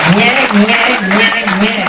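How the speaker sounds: high, loud mock American twang; hammed-up American accent. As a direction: caricature Americans with wide-open twanging vowels. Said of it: "That's why they call it an American twang".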